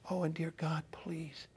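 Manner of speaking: hushed, imitated plea to God; soft, breath-only imitation of praying; whispered prayer. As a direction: retell a boy's prayer in a near whisper. Retelling boy's tearful prayer.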